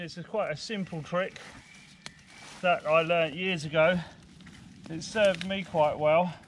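ordinary talk

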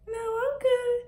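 high, squeaky refusal; sister's reply. Retold or imitated. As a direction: decline in a high voice. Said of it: "I asked her".

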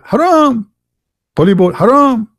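high, sneering "haram" rattled off like a ruling; mock cleric. Mocking clerics' bans.

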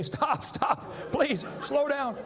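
high, slow, pleading mock scream; kids screaming stop. Fourth graders on the fast merry-go-round, high frightened pleading. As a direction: beg like a terrified kid on a spinning ride. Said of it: "But now they're screaming".

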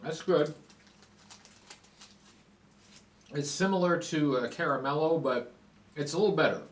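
talking while chewing; chewing between words; mouth full of chocolate. Real, not acted. Seen 2: Holds bitten chocolate bar; cheeks and lips move chewing; bites again at 5.3.

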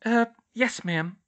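a touch sheepish, high voice; hesitate, then meekly agree. A narrator's reading. Hermione obeying the nurse, mildly sheepish. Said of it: "Hermione said sheepishly".

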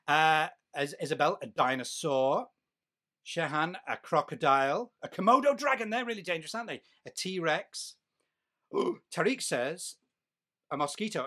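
usual speaking voice

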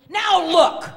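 very loud, high, exasperated outburst; hammed-up grumpy shout; grumpy walrus. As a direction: snap it out as a fed-up walrus. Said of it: "grumped Missus Walrus".

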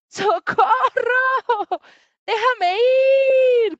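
high, drawn-out loud yell; screaming for help. Acted. Little leopard trapped in a monkey's hug, screaming for release. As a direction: wail it out, stretching the vowels. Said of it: "Grita Leopardito".

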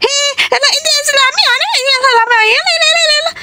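put-on high, fast child voice; high, quick kid chatter; excited child. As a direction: call out like a hyper kid. Child character calling out.

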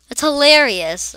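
high, loud amused; delighted. Real, not acted. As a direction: delight at the name. Delighted reaction.

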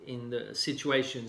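ordinary talk

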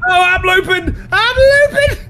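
high, loud excited shout; excited yelling. Real, not acted. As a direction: yell it while being chased. Looping the monster, yelling.

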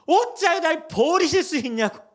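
high, loud anguished cry. Retold or imitated. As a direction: wail it out at God. Voices cry of forsakenness.

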